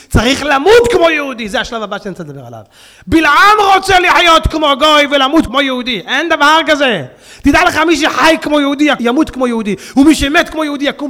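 loud, driving preaching; preaching at full force. Acted. Rabbi warning his congregation about life and death, loud preaching. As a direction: pound each line home from the pulpit.

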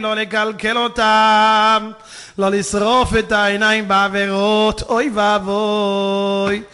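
loud, drawn-out chanting plea; preach. Real, not acted. Preacher warns congregation to guard their eyes, wailing loudly. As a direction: cry out each phrase with long held vowels.

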